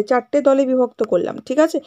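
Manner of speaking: ordinary delivery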